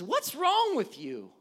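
loud, high, drawn-out accusing question; confrontational inflection. Shown as a demonstration. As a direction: lean hard and slow on the challenge. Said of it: "things like voice inflection".

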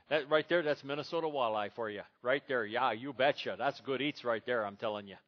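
hammed-up Minnesota accent. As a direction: play a Minnesotan with a joking local accent. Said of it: "I've lost my Minnesota accent".

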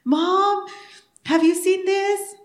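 put-on high, drawn-out kid's call; child calling Mom.

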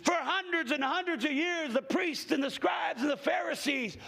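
slow, high, shouted sermon cadence; full-force preaching. Acted. Preacher asking the congregation why the woman touched Jesus, shouting.

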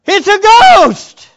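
loud, high, drawn-out cry of alarm; screamed. Acted. Disciples seeing a figure on the lake, screaming. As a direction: scream it out in shock. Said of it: "They scream".

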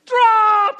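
hammed-up desperate shout; frantic yell. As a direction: shout at the running man to drop. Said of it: "And you're like".

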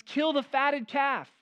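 a jubilant father calling for a party; joyful father. Retold or imitated. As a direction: announce the feast with joy. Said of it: "He says, throws a party".

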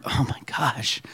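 breathless whispered "Oh my gosh"; awed whisper. Real, not acted. Pastor overwhelmed by the verse, whispering in awe.

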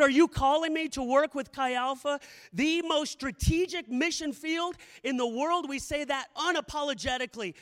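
usual speaking voice

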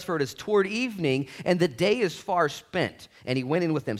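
loud, high sermon reading; booming preacher reading; preaching at full force. Acted. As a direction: proclaim the scripture to the room.

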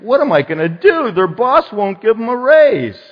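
mock helplessness. Preacher parodying a Jesus stumped by circumstances, mock helpless. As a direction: play at being stumped, voice climbing.

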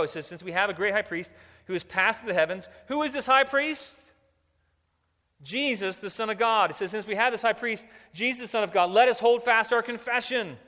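loud, high, driving pulpit voice; rousing sermon with ringing scripture quotes; impassioned preaching. Real, not acted. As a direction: proclaim it at full force to the congregation. Preaches at full force.

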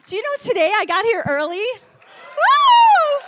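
loud, high, fast whoop of joy; joyful cry of woo; excited shout. Real, not acted. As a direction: celebrate with the crowd. Excited announcement to congregation.

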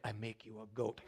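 soft, low mock voice of a proud cook; imitating Nancy. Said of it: "she's like".

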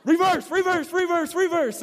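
high, loud, urgent yell to stop; panicked shout. Retold or imitated. Intern guiding van yells at driver to stop, high panicked shout. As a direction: bellow it like someone about to see the van crash. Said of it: "he just goes".

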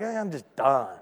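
low, soft, worn-out sigh of a line; fed up, done. Pretend. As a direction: say it like you've given up, low and quiet. Voices a worn-out worker.

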